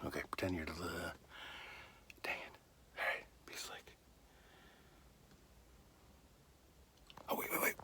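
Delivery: very faint, furtive whisper. Real, not acted. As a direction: whisper to yourself while sneaking a look. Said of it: "Why am I whispering?".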